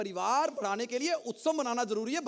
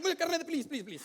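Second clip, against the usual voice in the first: begging that starts high and sinks softer; urgent plea fading away; pleading; 5 semitones higher, 4 dB quieter, faster than usual. Acted. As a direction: beg for permission, trailing into small repeated pleases. Begging to be allowed to urinate.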